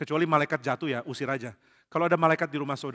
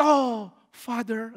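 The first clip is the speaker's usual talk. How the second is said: slow, higher, put-on awe; mock awed child; 7 semitones higher, 4 dB louder, much slower than usual. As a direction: drawl it like a starstruck kid. Anak kagum ke papa. (anak pura-pura kagum, lambat)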